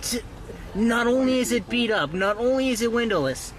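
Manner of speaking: usual speaking voice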